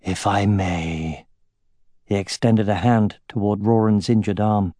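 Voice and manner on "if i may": low, slow, soft and smooth; smoothly, softly ask permission; an elf's smooth, unhurried murmur. A narrator's reading. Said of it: "in a smooth voice said".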